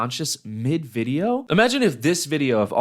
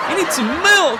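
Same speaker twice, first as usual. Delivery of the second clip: giggling through the line, much higher; laughing repeat; 5 dB louder than usual. Real, not acted. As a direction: laugh as you say it.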